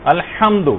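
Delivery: الحمد with a light ہ instead of ح; غلط تلفظ الحمد; mispronounced Alhamd. Shown as a demonstration. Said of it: "لوگ پڑھتے ہیں الحمدو".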